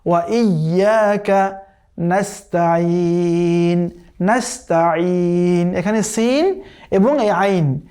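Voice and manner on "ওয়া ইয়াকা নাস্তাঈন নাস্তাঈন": slow, with a thin সিন and a throaty আইন; নাস্তাঈন with the ain from the throat; correct tajweed. Shown as a demonstration. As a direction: draw out ওয়া ইয়াকা নাস্তাঈন carefully. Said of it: "এটাকে পড়তে হবে ওয়া ইয়াকা".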